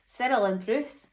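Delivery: ordinary delivery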